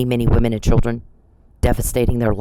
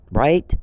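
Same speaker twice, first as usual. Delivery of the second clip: "right" tagged on with a rising tune; pointed questioning tone. Real, not acted. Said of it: "I'm asking as a question".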